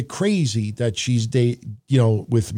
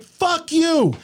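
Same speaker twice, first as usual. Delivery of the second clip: high, angry fuck you thrown back at her; mock yelled back; 13 semitones higher than usual. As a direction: retort it through the window. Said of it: "I'm like".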